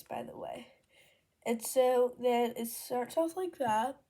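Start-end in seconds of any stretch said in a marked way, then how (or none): 0.0-0.7 soft unvoiced whisper tossed in; whispered aside. Real, not acted. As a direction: drop to a whisper for a quick aside. Whispered aside about Xavier.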